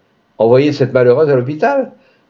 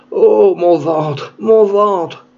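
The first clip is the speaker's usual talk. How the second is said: slow, higher moans of a woman in labour; stammering in pain; 6 semitones higher, much slower than usual. Acted. Woman in labour groaning to her lover, slow and pained. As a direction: drag the words out through the pain. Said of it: "Elle balbutia".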